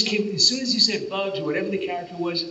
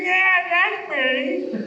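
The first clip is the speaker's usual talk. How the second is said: put-on high, louder, very slow old man with a laugh; 5 dB louder, much slower than usual. Actor confirming he's the voice of Duke, old man voice. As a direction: stretch it out, cackling old man. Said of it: "I said".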